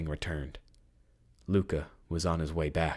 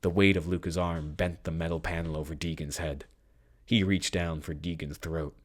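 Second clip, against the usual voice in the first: usual speaking voice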